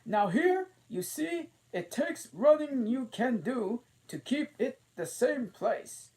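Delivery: high, loud scornful queen; the Queen mocking Alice's country. A narrator's reading. The Queen explains running.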